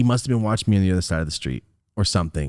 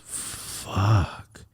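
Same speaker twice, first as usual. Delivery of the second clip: breathy whispered curse; whispered fuck; 6 dB quieter than usual. Retold or imitated. Friend hears the bad news and whispers in dismay. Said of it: "He goes".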